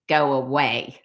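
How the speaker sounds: low, unbothered copycat repeat; teasing low-pitched parroting; mimicking echo. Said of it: "when somebody repeats everything you say".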